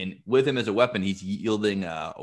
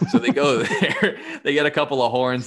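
laughing; 6 semitones higher, 7 dB louder than usual. Real, not acted. Laughing while telling story.

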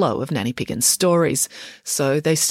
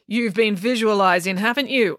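mildly accusing question, a little raised; 5 semitones higher than usual. A narrator's reading. Nanny catching Boris visualising, mildly accusing. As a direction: put it to him as a light accusation. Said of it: "accused Nanny Piggins".

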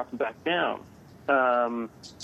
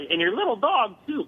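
Wicked Witch quote; 7 semitones higher than usual. Retold or imitated. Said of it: "I can't do the Wicked Witch voice".

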